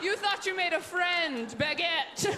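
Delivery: put-on high, gleeful baby gloating; taunting baby. Baby wheeled away taunts the stranger, high mocking.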